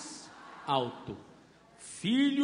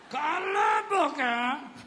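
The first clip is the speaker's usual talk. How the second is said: mother's rebuke; 6 semitones higher, slower than usual. Retold or imitated. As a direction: drag out a stern mother's hush. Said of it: "beliscão assim na gente".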